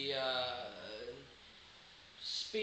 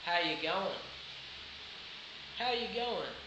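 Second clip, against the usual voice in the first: Australian accent. Shown as a demonstration.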